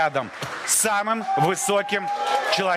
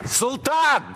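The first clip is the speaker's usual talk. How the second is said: shouting; 12 semitones higher than usual. Real, not acted. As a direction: shout his name so he hears. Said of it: "Буду кричать громче".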